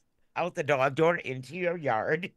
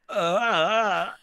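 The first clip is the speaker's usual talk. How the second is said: exasperated grunt; 3 semitones higher, 6 dB louder than usual. Retold or imitated. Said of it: "he's gonna go".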